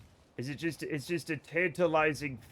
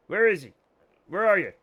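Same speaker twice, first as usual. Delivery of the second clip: louder call for the dog on where; louder searching call; 2 semitones higher, 5 dB louder, faster than usual. Real, not acted. Looking for the dog.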